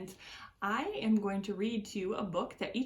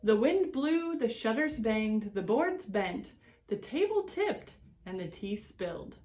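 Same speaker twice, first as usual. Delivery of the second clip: put-on reading to children. A narrator's reading. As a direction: read it like story time for little ones. Reads picture book to children.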